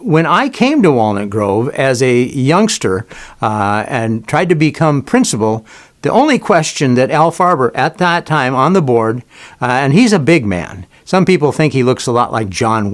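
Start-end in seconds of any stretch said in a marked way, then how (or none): none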